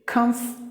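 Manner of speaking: comfortable split as kumf, tuh-bul; silent letters. Shown as a demonstration. Teacher introducing the word, comfortable reduced to kumf-tuh-bul. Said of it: "The next word is".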